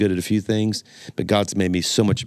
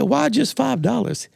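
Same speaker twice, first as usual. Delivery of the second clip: very high, slow disbelief; incredulous joke character; slower than usual. Acted. Thibodeau asks Boudreaux about the price, incredulous. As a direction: question it in high-pitched, drawn-out puzzlement.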